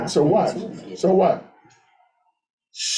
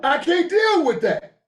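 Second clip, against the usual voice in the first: much higher, emphatic refusal; agitated protest; 11 semitones higher than usual. Real, not acted. Speaker refusing to live broke, agitated protest. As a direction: pitch it high, refusing outright.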